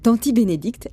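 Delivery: usual speaking voice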